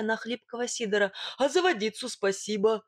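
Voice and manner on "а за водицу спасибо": gruff thanks from a bristling bogatyr; bullish. A narrator's reading. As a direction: thank him grudgingly, standing your ground. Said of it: "набычился богатырь, из-под лобья взирая".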